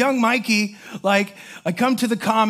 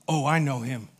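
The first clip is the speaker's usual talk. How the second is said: low quiet quote; 7 semitones lower, 5 dB quieter than usual. Retold or imitated. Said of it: "He goes".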